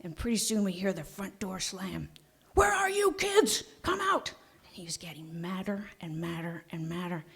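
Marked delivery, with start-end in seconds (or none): 2.5-4.3 high, slow angry shout; dad shouting. Retold or imitated. Dad yells across the street for his hidden kids, high slow shout. 4.7-7.2 hushed whisper, building dread; whispered suspense. A narrator's reading. Storyteller builds suspense for the audience, whispering.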